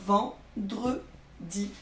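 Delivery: "vendredi" very slowly, split ven, dre, di; syllable by syllable. Shown as a demonstration. Teacher reading the finished word to children, syllable by syllable. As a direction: say "vendredi" with a gap after each syllable.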